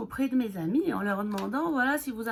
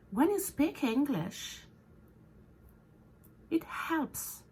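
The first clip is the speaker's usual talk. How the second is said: English intonation; 5 semitones higher, much slower than usual. Shown as a demonstration. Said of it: "les intonations de l'anglais".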